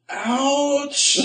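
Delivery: high comic yelp of hurt; mock pained ouch. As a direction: squeak it out as a wounded joke. Left alone in bed.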